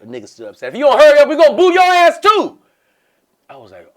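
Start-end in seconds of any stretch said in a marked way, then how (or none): 0.7-2.6 loud high threatening heckle; mock rowdy heckler; heckler yell. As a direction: shout it at the stage.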